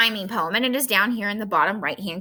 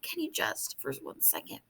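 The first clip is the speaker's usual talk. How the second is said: hushed pleading whisper; begging the birds for quiet in a whisper; 10 semitones lower, 17 dB quieter than usual. Acted. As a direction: whisper it so as not to wake anyone. Poem asks birds for quiet.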